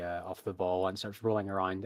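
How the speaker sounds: usual speaking voice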